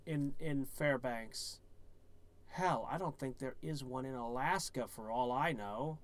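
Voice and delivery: normal talking voice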